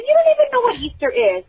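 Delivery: high-pitched disbelieving comeback; mock-exasperated parent arguing back; retold incredulous retort. Parent retorting to three-year-old, retold incredulity. Retold argument with crying child.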